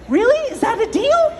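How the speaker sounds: high, loud hopeful question; eager rabbit. Acted. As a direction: ask it with sudden excited hope. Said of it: "feeling energized now".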